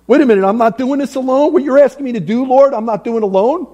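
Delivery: amazed realization. Acted. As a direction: realize it with growing wonder, hurried. Joshua realizes God is with him.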